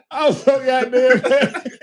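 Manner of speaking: high, slow words broken by laughter; can't hold back the laugh. Real, not acted. Laughs retelling his anger.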